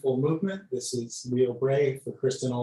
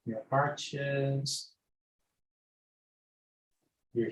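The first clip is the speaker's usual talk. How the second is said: guided meditation. Acted. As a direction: lull them gently from toes upward. Leads body scan meditation.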